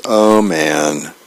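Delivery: low, sinking groan of resignation; weary comic-strip dad reaction; dismayed dad. Acted. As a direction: let it drop heavily, mildly disgusted.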